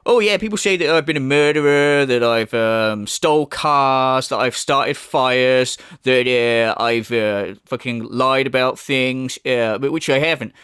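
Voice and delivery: slow, low, plodding mimicry; mocking impersonation. Critic mimics the streamer listing his supposed crimes, mocking. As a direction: drag out the list in a dopey mock voice.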